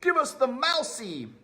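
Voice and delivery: high, loud squeaky rat cry; greedy rat yelling; rat shouting. Acted. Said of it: "shouted one of the rats".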